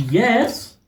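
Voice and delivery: high, delighted acted cheer; so pleased. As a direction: celebrate a new video with a squeal. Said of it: "I'm, like, so pleased".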